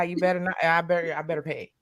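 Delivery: ordinary talk, nothing marked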